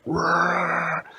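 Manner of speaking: hammed-up bear roar; mock roar. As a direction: growl it out like a bear. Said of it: "the Freddy was like".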